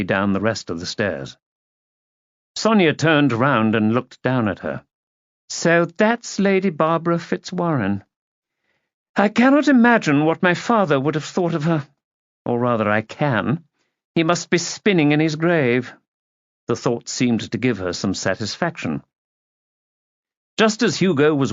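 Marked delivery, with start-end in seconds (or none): 5.5-15.9 higher-pitched society lady's disdain; haughty disapproving aunt. A narrator's reading. Aunt looking down at her niece, haughtily disapproving. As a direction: pronounce judgement grandly.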